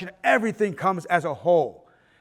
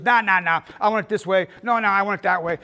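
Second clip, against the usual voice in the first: imitating a demanding producer; 2 semitones higher, 8 dB louder than usual. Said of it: "And she goes".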